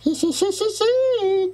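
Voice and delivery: slow, very high tittering giggle. Acted. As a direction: giggle like a tiny cartoon turtle. Turtle giggles after smile made.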